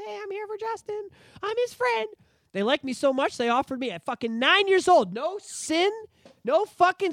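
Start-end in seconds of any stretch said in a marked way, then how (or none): 0.0-2.1 put-on little kid voice. Kid voice at neighbors' doors.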